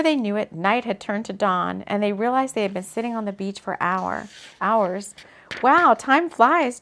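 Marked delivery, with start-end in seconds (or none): 5.6-6.8 slow and drawn out, louder; sleepy. A narrator's reading. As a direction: stretch it out sleepily. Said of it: "Jade said sleepily".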